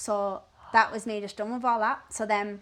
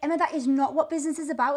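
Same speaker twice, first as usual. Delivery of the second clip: high, quick, louder objection; retold indignant correction; 6 semitones higher, 5 dB louder, faster than usual. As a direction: act out an indignant telling-off. Caller objects in disbelief.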